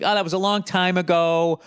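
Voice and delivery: high, breezy brush-off; mock someone waving away history.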